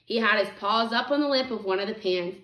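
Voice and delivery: plain reading voice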